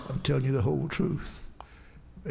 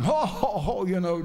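imitating a scornful ho ho ho laugh, higher; mocking laugh; 4 semitones higher than usual. Son-in-law laughing at the preacher's prayer, mock jeering laugh. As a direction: play the son-in-law's jeering chuckle. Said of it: "he started laughing".

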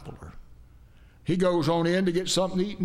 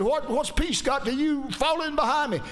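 much higher, insistent retold command; raised-voice retort; 9 semitones higher than usual. Jehu orders the second rider behind him, raised-voice retort. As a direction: pitch it up high, acting out a commander's retort.

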